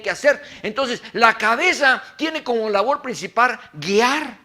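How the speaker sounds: loud, high impassioned sermon; fiery pulpit delivery; full-force preaching. Acted. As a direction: preach it out to the whole church.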